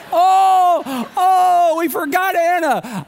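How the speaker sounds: high, loud panicked cry of a mother; panicked wife. Retold or imitated. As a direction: wail it like you just lost your child. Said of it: "she's like".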